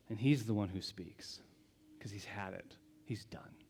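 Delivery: hushed whisper. Real, not acted. Father has had it, hushed.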